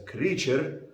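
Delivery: "creature" with an Arabic-style R; Arabic accent. Shown as a demonstration. Teacher showing an acceptable variant, Arabic-accented "creature". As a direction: say "creature" as an Arabic speaker would. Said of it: "you may say it as".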